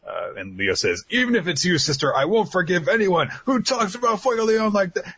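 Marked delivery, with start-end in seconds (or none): 1.1-5.2 higher, fired-up defiant yell; indignant high shout. Retold or imitated. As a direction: shout it like a loyal hothead. Leo defends his captain.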